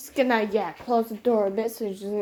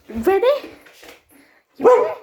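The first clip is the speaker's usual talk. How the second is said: each ask climbs higher and louder; building excitement; 9 semitones higher, 7 dB louder than usual. Real, not acted. Owner building her dog up to jump, rising excited pet talk. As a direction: rev the dog up more and more.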